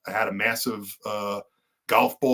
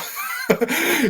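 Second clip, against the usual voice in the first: quick high burst of laughter; a bright amused laugh; short laugh. Real, not acted. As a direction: laugh at his own joke. Laughing about his mom's nickname.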